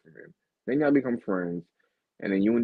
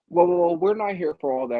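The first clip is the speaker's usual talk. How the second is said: imitating Rivers; 10 semitones higher, 4 dB louder than usual. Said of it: "he was like".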